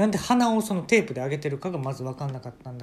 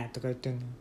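ordinary delivery

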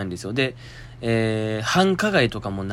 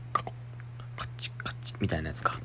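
whisper; 6 semitones lower, 13 dB quieter than usual. Real, not acted. Whispered guess.

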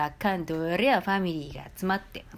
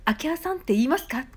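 mock voices, much higher; lightly voiced tutor and director; imagined film-set dialogue; 9 semitones higher than usual. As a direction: act out the director and dialect coach lightly. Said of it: "とか言われても".

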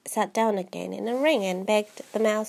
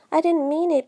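pleading; 5 semitones higher, 4 dB louder than usual. A narrator's reading. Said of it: "pleaded poor Alice".

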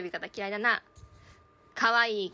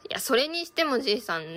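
ordinary talk, nothing marked

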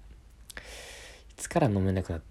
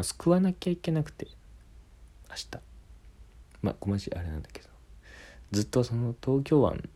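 soft whisper; 3 semitones higher, 5 dB quieter than usual. Shown as a demonstration. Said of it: "ささやき声で".

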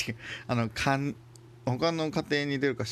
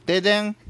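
loud high "ででん" jingle; quiz jingle shout; 10 semitones higher, 10 dB louder than usual. Pretend. Quiz show sound effect voice. (クイズ番組の効果音を叫ぶ)

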